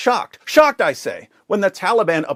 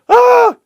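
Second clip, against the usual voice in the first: short high loud mock shriek; a burst of fake panic, much higher and louder; mock panicked scream; 11 dB louder than usual. Commentator mimics claustrophobic agoraphobe journalists, high mock shriek.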